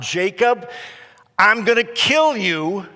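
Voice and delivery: loud, high furious cry; enraged Esau. Retold or imitated. Cheated brother threatening his twin, enraged shout. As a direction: shout the threat in a retold rage. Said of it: "he cries out".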